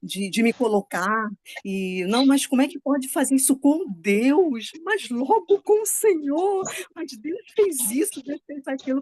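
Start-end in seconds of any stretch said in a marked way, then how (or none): 2.1-9.0 higher, incredulous whine at God; mock indignant complaint. Mocking her own habit of complaining to God, indignant whine. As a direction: play up the outrage, then let it sag. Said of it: "eu também tenho essa mania".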